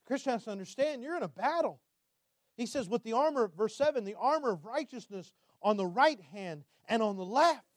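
loud, emphatic sermon delivery; full-force preaching. Acted. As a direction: declare it forcefully to the congregation.

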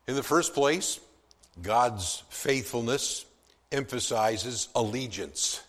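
ordinary talk, nothing marked

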